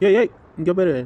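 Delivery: loud call to stop someone; sharp hail. Acted. Man halting a woman walking off, loud hail. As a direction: raise the voice to grab their attention.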